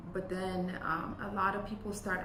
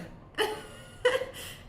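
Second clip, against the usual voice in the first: laugh; 4 dB louder than usual. Real, not acted. Laughing at funny coffee trailer story.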